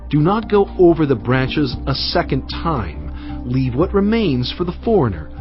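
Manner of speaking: ordinary delivery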